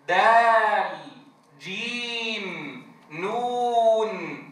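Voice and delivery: دال, جيم, نون each held very slow, sung out; teacher modelling full vowel length; long vowel letter names. Shown as a demonstration. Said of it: "اجعل الطالب يتقنه".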